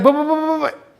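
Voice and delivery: high, sputtering mock stammer; flustered stammer. Suitor turned down for a date, stammering in mock fluster. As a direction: stutter it out high, lost for words.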